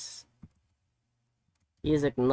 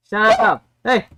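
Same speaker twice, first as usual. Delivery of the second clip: loud, high scolding shout; yelling at dog; 6 semitones higher, 7 dB louder than usual. Real, not acted. Owner scolding the barking dog, real shout.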